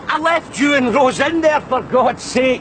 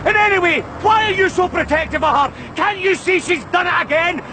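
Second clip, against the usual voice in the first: heated outburst, building to a higher shout; angry shouting; 3 semitones higher than usual. Acted. Accused man rowing with his own brother, angry shouting. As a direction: lash out in exasperation, driving each accusation home.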